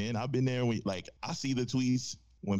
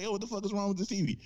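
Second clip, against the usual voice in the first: higher, quicker exasperated gripe; frustrated complaint; 10 semitones higher, faster than usual. Retold or imitated. Man banging on his broken TV, fast, higher-pitched annoyed gripe. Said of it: "You're like".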